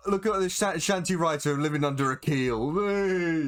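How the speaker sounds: high, mocking crowd jeer; jeering heckler. Crowd member to the ignorant shanty writer, high jeering.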